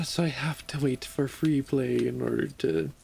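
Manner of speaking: normal talking voice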